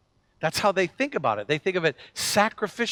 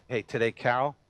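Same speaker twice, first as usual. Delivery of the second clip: hushed voice of God; 6 semitones lower, 8 dB quieter than usual. Pretend. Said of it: "and say".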